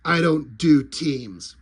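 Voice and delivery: low, slow tough-guy growl; deadpan grim vigilante, low and slow; gruff hero. Retold or imitated. Popo refusing to cooperate, low and deadpan. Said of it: "He's like".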